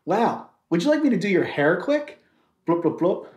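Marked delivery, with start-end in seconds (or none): 2.7-3.3 low plopping whale; whale noise. Pretend. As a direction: make deep little plops as a whale. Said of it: "that's the sound a whale makes".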